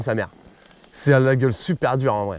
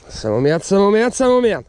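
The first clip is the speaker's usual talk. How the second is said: rising, louder frustrated complaint; frustrated outburst; 6 semitones higher, 3 dB louder than usual. Real, not acted. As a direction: let the annoyance boil over. Repeats annoyance, building.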